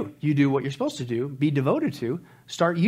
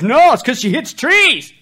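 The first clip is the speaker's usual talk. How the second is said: high, loud, slow punchline; 10 semitones higher, 11 dB louder, slower than usual. Retold or imitated. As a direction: land the punchline big. Punchline of party joke.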